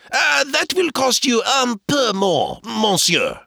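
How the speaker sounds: put-on high, slow French-accented dealer; French horse dealer. Dealer upping the price, high French-accented voice. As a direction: haggle in a high Cajun French lilt.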